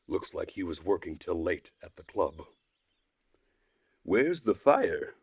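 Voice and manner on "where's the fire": slow, higher, louder teasing call; a hint of a puzzled boy; lightly voiced curious boy; Electronic's voice. Electronic stops runaway.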